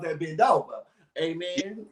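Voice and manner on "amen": usual speaking voice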